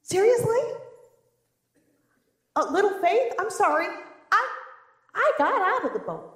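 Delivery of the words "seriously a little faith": high, loud seriously; offended incredulity; indignant disbelief. Pretend. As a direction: protest as a stung Peter. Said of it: "I put myself in Peter's position".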